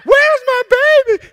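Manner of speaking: very high, loud, slow wail; hammed-up frantic scream. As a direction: scream it like a panicking mother. Mary panics, searching for Jesus.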